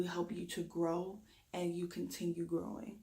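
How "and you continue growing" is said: usual speaking voice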